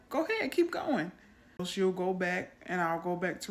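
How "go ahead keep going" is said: higher, bright urging; encouraging mom. Retold or imitated. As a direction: coax the child to keep going. Mother urging puzzled daughter.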